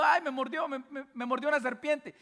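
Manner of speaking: imitando a un niño que se queja, un poco más agudo; niño llorando. Retold or imitated. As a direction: hace la voz del hijo mordido, quejándose. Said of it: "un padre con su hijo llorando". (leve imitación de un niño que llora)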